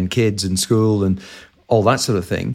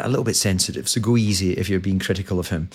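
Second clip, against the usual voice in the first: usual speaking voice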